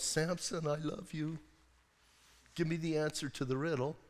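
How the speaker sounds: lightly voiced, soft pleading; softly coaxing wife. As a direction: coax him quietly, as his wife. Wife coaxing Samson for answer.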